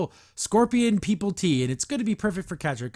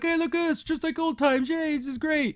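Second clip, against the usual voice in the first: high-pitched, chirpy imitation; mock cheerful; 10 semitones higher than usual. Bo reliving old times with friends, mock cheerful. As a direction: pitch it way up in pretend delight.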